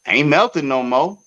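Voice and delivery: normal talking voice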